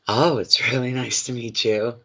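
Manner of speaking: gushing, overeager greeting, lightly hammed up; mock desperate. Psychic shows client how a desperate dater sounds, mock gushing. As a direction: play it too keen, clinging to every word. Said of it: "you're not desperate".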